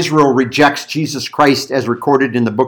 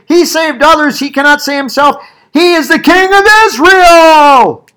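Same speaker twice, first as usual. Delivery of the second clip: mocking; 13 semitones higher, 5 dB louder than usual. A narrator's reading. Chief priests mocking Jesus on the cross, loud jeering. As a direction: sneer it out, then proclaim it derisively. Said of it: "they were mocking him".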